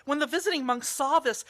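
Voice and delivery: normal talking voice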